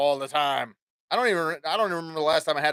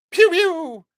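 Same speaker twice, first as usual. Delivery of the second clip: high, loud "pew pew" blaster noise; mock gunshots; 12 semitones higher, 6 dB louder than usual. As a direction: spit out toy laser shots. Mimicking gunfire in a game.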